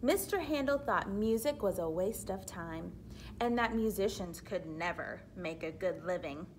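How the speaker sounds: ordinary delivery, nothing marked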